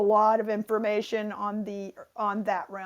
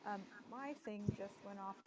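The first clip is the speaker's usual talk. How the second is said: faint and distant, bad connection; speaking from far off the mic; 2 semitones higher, 17 dB quieter than usual. Real, not acted. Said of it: "it sounds like you're far away".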